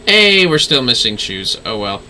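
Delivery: usual speaking voice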